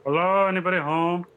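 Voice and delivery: loud call into the hole. Acted. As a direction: shout a cheeky hello. Calling into the mound.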